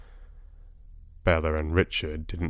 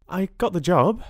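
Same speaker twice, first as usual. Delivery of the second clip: overjoyed; 10 semitones higher than usual. Acted. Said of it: "a voice that said her cup of happiness was running over".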